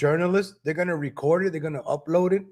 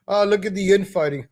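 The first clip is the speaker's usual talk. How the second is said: mock gloating laughter. Imagined media jeering at conservatives fighting each other, mock laughter. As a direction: laugh mockingly through the words. Acting out media mocking the infighting.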